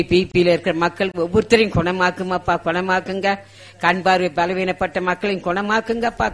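ordinary delivery

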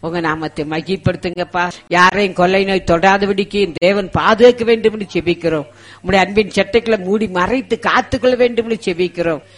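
usual speaking voice

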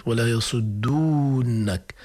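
"يصدونك" with a long held uu; original long waw. Shown as a demonstration. Teacher restores the deleted waw, long uu in "يصدونك". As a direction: stretch the uu of "يصدونك" slowly. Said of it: "هاي واو الجماعة لما حذفت هي أصلت".

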